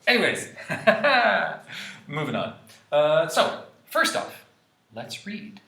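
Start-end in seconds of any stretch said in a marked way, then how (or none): none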